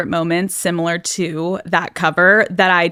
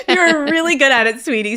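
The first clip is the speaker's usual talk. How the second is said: giggly, higher praise through a laugh; 7 semitones higher than usual. Real, not acted. Host teasing guest about breaking the internet, laughing. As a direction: laugh it out while complimenting her.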